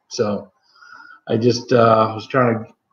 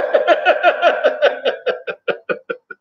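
high breathy laugh. Real, not acted. Reacting to a funny chat comment.

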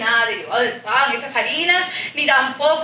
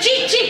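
"Chichi" stressed on its second I; "Chichi" with an accented French-style ending; stress on final I; 10 semitones higher than usual. Shown as a demonstration. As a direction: correct it firmly, landing on the last syllable of "Chichi". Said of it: "con acento en la segunda I".

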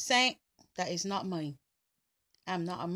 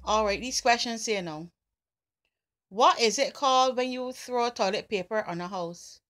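ordinary talk

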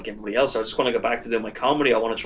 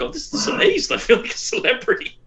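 high-pitched thrilled squeal, played for fun; retold giddy delight; 12 semitones higher than usual. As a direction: gush like a newly minted celebrity. Retelling his delight at the turnout.

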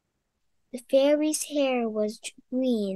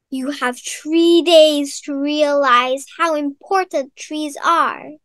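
witch voice; 2 semitones higher, 8 dB louder than usual. Acted. Witch threatens the girl.

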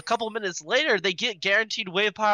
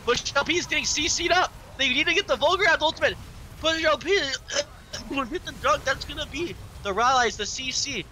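higher, excited esports casting; live play-by-play; 8 semitones higher than usual. Real, not acted. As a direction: call the fight live, pitch up high. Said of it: "need to stop casting play-by-play".

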